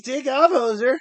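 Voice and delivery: loud, high hammed-up Canadian jab; mock Canadian hoser. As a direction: toss it off like a joking Canadian insult. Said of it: "That's what they would say to me".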